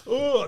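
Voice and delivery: high mock groan; imitated queasy groan. Storyteller mimicking sick recruits trudging off the plane, imitated queasy groan.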